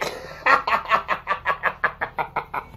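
long staccato cackling laugh; long cackle. Real, not acted. Speaker mocking rivals to viewers, drawn-out cackle. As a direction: cackle on and on, letting it run down.

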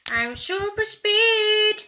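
high, loud triumphant crowing; gleeful high singsong boast; excited gloating. Real, not acted. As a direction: crow it loudly, stretching the words. Gloating about speed and money.

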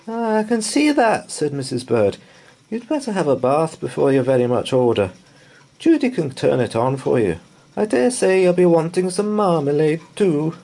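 high, loud, brisk orders; a fierce housekeeper fussing. A narrator's reading. Mrs Bird sending the bear to the bath, brisk.

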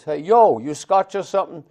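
put-on high, loud tough-kid challenge; imitating a street kid. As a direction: call out like a cocky New York teenager. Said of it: "And, and one of the kids".

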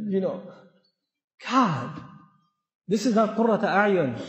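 usual speaking voice

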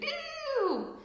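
very high squeal of disgust; disgusted scream. Acted. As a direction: squeal it like a sprayed hog. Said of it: "who screamed".

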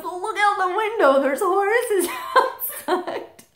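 high, loud, eager insistence with a laugh breaking in; excited brother. Retold or imitated. Brother calling to his sister from the next room, excited and laughing. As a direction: urge her to come see, nearly laughing.

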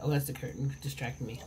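ordinary delivery

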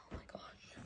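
soft unvoiced whisper of amazement; awed whisper. Real, not acted. Kid unboxing new gym clothes whispers in awe. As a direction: breathe it out in wonder.

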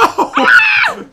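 loud, high excited yelp; excited shout. Real, not acted. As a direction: yelp out in thrilled surprise. Excited while crossing.

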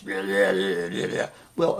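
mock-gruff heavy metal growl. Shown as a demonstration. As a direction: put on a really gruff voice, drawn out. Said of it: "If I had a really gruff voice".

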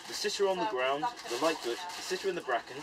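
put-on reciting an old list poem; poem recitation. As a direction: read it like a ballad of names. Reciting old poem of hare names.

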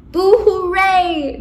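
high, loud, stretched cheer. A narrator's reading. Both cheering the finished list, drawn-out cheer. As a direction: celebrate it slowly and big.

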